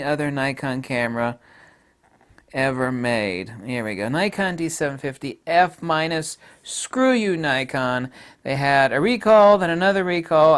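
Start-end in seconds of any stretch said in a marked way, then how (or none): none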